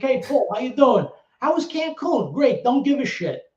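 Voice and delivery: high, loud promoter impression; high-pitched retold phone patter; imitating promoter. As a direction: mimic the promoter's hearty greeting, pitched well up. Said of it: "that's how he used to talk to me".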